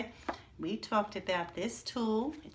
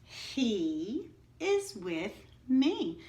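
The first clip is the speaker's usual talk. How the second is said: slow, even sentence read aloud; slow model reading; much slower than usual. Shown as a demonstration. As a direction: read it slowly so they can follow. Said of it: "read them with me again".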